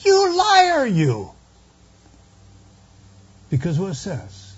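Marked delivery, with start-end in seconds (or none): 0.0-1.3 a sudden high, loud shriek; high-pitched cry. Retold or imitated. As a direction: cry out in a high shrill voice of the old preacher. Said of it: "he cries out in high-pitched voice".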